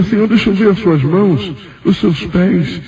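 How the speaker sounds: high, beseeching retold request; pleading. Believer begs to see hands and feet, high pleading. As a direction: beg in a high-pitched voice.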